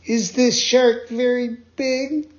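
high, slow trembling question; frightened Pinocchio. Acted. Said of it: "beginning to tremble with fright".

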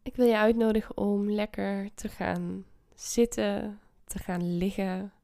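guided meditation. Acted. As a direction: ease them into calm. Leads a meditation.

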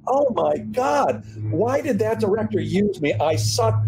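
high, slow, mock horrified groan at yourself; mock despair watching yourself on tape; self-critical dismay. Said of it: "every time I would look at it and go".

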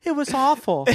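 hammed-up crying; mock sobbing. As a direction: wail it through fake tears. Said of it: "through her tears".

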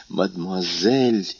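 "Мадмуазель" slowly, stretched out, incredulous; indignant exclamation. A narrator's reading. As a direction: throw "Мадмуазель" back at him, offended and slow. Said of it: "воскликнула Валентина".

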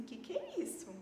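high, startled "que que é isso"; a daughter seeing the exam result; startled question. Retold or imitated. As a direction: blurt it out in alarm. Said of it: "Aí eu olhei e falei assim".

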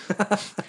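short high laugh. Real, not acted. Laughs at bizarre Krampus cards.

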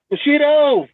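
calling out. Real, not acted. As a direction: call his name high to find him. Calling out after lost connection.

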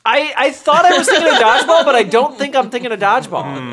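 high, loud, laughing self-defence; laughing protest. Real, not acted. Defending a hazy movie memory to co-hosts, laughing. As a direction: protest loudly through real laughter. Laughs at own possible mix-up.

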